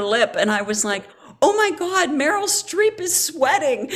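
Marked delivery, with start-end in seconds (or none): 1.4-3.9 giddy relief. Retold or imitated. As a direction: act out a thrilled revelation, pitched high. Acts out her giddy relief.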